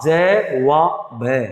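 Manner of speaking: very slow syllable by syllable; spelled out slowly. Shown as a demonstration. Teacher breaks जवाब into syllables for students. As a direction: spell each sound apart. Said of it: "हम जवाब बोलते नहीं".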